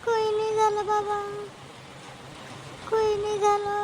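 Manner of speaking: high, tearful voice through crying. Acted. Daughter-in-law tells father-in-law nothing is wrong, crying. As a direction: choke the words out through tears. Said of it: "काहून रडून राहिली तू?".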